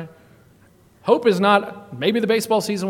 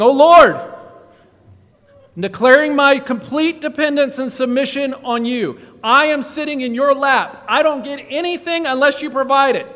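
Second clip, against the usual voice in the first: loud fervent prayer; 7 semitones higher, 8 dB louder than usual. Acted. Voicing David's prayer.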